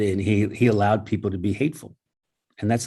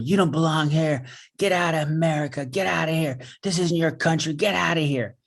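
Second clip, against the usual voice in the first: retelling a woman yelling at a stranger, raised-pitch mock anger; imitating a hateful yell; 6 semitones higher than usual. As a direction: replay the harasser's hostile ranting, higher but not shouted. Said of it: "there was a yelling at her".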